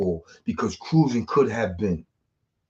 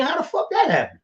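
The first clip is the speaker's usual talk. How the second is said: amazed disbelief. Retold or imitated. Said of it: "I'm saying".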